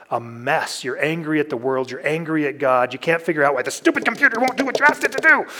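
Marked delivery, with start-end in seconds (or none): 2.9-5.5 fast, high mock rant at the computer; frustrated rant. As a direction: rattle it off like a frustrated user.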